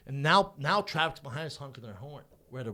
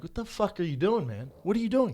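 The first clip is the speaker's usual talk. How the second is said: confronting; 7 semitones higher, 6 dB louder than usual. Retold or imitated. Retold confrontation, raised.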